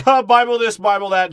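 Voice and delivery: high, loud dismissive scoff; loud mocking rant. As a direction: shout it in mock exasperation.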